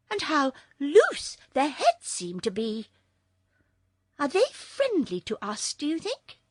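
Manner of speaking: put-on high, lightly girlish character voice; high voice, asking with interest; curious Polychrome. A narrator's reading. Character dialogue in story.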